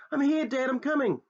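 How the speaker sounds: high, loud and eager; excited calling. Acted. Boy answering his father as he runs, excited shout. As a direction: shout back, running to him.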